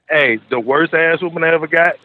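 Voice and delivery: normal talking voice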